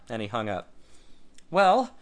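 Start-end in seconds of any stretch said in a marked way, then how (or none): 1.5-1.9 high, loud impatient demand; loud eager demand. A narrator's reading. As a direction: demand an answer now. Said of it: "Tad demanded".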